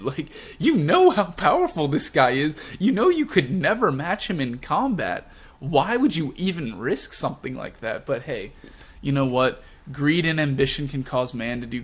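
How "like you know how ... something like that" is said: worked-up, punched-out disbelief; exasperated disbelief. Real, not acted. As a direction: argue it as if it's obvious. Exasperated at the villains' stupid plan.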